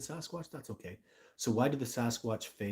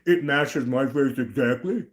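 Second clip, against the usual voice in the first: slow, louder put-on commenter voice; mocking imitation; 6 dB louder, slower than usual. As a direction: parrot the silly claim with derision. Mocks commenters' claim.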